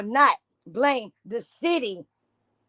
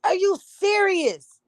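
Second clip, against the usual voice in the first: drawn-out, higher, disbelieving ask; slow disbelief; 8 semitones higher than usual. Real, not acted. Reacting to the news clip, slow stunned disbelief. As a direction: stretch it out in pure disbelief.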